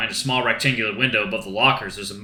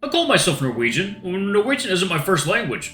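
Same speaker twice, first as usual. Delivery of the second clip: put-on higher, thoughtful young man; Bjorn voice; 6 semitones higher than usual. Bjorn telling the coach about his two homelands, higher thoughtful voice. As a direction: muse openly, pitched up.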